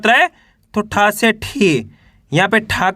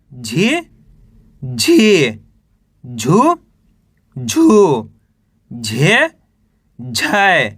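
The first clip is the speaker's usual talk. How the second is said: lower, each syllable set apart by pauses; syllable drill; 6 semitones lower, much slower than usual. Shown as a demonstration. Teacher reciting the ga row to students, lower and deliberate.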